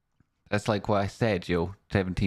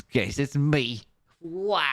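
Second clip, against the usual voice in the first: excited; 8 semitones higher, much slower than usual. Real, not acted. Greeting chat after gifts.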